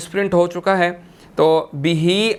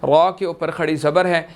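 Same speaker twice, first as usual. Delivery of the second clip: usual speaking voice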